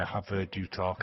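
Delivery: usual speaking voice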